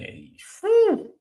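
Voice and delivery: whistling high whew of disbelief. Retold or imitated. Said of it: "I'm like".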